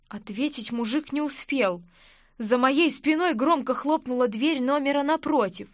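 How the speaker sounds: plain reading voice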